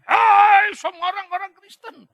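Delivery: high, loud, slow imitated speech-hall shout; retold shout. Pastor imitating a church leader's speech to Christians, shouting. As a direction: belt it out like a speaker rousing a hall. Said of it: "Dia teriak begitu".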